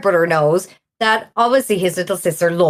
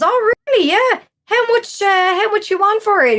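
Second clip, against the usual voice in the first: much higher, eager retold voice; thrilled brother; 12 semitones higher than usual. Brother keen to buy the Atari from the regular, excited and high. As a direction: jump at the deal, pitched way up.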